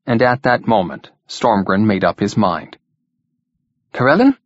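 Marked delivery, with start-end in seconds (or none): 3.9-4.4 abrupt call with a sharply rising, louder end. A narrator's reading. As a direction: cut in abruptly, pitched up high. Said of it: "he said abruptly".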